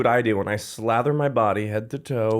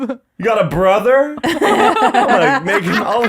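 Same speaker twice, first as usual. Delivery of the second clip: laughing mock quip; 8 semitones higher, 5 dB louder, much slower than usual.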